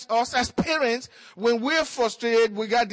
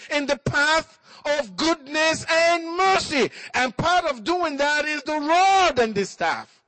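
raised, ringing sermon voice, building to shouts; preaching; 6 semitones higher than usual. Acted. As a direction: preach it full force to the congregation. Preacher exhorting parents.

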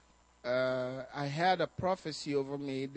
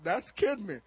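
ordinary talk, nothing marked

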